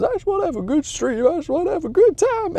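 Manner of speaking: very high, strained mock sob; imitated crying. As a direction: whimper it like a broken gamer close to tears. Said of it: "he starts really crying".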